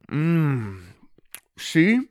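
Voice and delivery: chewing while talking; showing the villagers food while chewing; mouthful. Acted. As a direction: talk round a bite of chocolate. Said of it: "he said with a mouthful".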